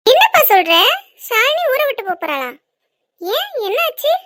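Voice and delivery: high, startled questioning; alarmed mother quizzing her son; shocked. Acted. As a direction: pitch leaps up at unexpected news. Mother shocked at son's news.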